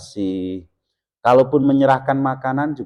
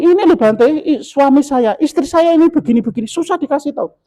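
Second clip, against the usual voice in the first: much higher, louder whiny complaint; complaining wife; 6 dB louder than usual. Retold or imitated. Follower complaining to the monk about her spouse, high whiny grumble. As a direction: grumble about the stubborn husband. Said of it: "mesti ngomong".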